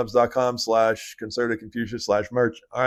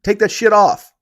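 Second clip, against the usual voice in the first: loud callout; 7 semitones higher, 8 dB louder than usual. Pretend. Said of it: "I would be like".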